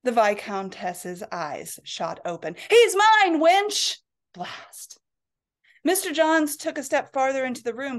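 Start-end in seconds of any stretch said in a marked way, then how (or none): 2.7-4.0 loud, much higher cry out of sleep; old lady shouts as she wakes, loud and high; startled waking shout. Acted. Viscountess jolts awake shouting. 4.3-5.0 whispered curse. Acted. Muttered private curse.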